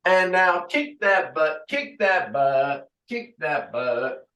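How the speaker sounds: upbeat class count, sung out; fitness instructor calls. Acted. Instructor counting reps for the class. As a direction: lead the class through reps. Leading cooldown and stretch.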